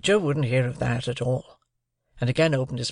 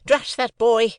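high, louder, scolding outburst; cross sister; 10 semitones higher, 5 dB louder, slower than usual. A narrator's reading. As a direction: snap at the boy in irritation. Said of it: "interposed my sister".

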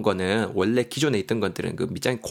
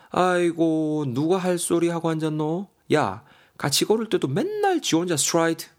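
higher-pitched, exasperated acted complaint; upset retort; 6 semitones higher than usual. Wife fires back at her partner in a quarrel, acted upset. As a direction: throw it back, hammed-up exasperation. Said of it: "미정이가 속상해서 말을 하고 있어요".

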